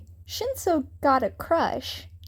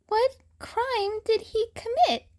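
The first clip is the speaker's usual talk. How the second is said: put-on young boy voice; 10 semitones higher than usual. A narrator's reading. Newcomer voiced, questioning bullies.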